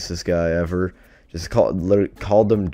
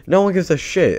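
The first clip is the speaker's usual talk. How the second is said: indignant; 9 semitones higher, 7 dB louder than usual. Real, not acted. Storyteller outraged that nobody cared about the injured girl, indignant. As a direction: stretch it out louder and higher in disbelief.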